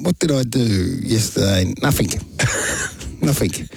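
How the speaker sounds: ordinary talk, nothing marked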